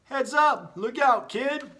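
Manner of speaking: high, loud call of warning; a sharp heads-up cry. Acted. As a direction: yell to the kid to duck. Ball calling warning to Gideon.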